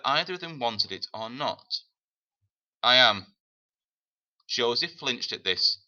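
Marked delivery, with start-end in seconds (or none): 2.8-3.4 short, loud, resolute answer; a tough woman's curt admission; firm woman. Acted. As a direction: claim him with firm resolve. Woman accepts responsibility.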